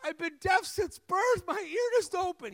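high-pitched imitation of a man crying out; retold crying. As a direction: act out his overwhelmed cry, starting slow. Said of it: "he's just crying".

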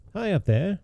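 high, lightly called up the chimney; a light storybook shout upward; mock shout. A narrator's reading. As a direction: call it up the chimney, story-style. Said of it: "shouted he".

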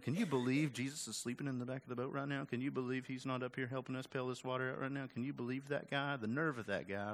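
hushed, gossipy grumbling; gossiping murmur. Retold or imitated. Disciples gossiping about sleeping Jesus, hushed grumbling. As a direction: mutter it like complaining behind his back. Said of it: "gossiping about Jesus".

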